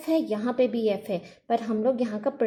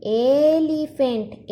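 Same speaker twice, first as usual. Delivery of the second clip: slow and louder, drawing out elephant; slow motion; 4 dB louder, much slower than usual. Shown as a demonstration. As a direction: stretch elephant to show the first sound. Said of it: "slow motion में पढ़े".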